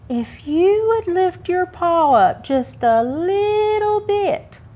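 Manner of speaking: high, eager squirrel voice; pleading squirrel. Acted.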